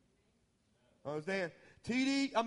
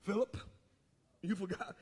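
teasing Jesus rebuke; 7 dB quieter, faster than usual. Retold or imitated. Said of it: "He said".

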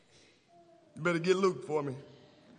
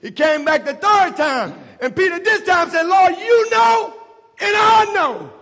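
higher, shouting climax, slowing on the last words; full-force preaching; 9 semitones higher, 11 dB louder than usual. Real, not acted. Preacher climaxes on Peter's answer.

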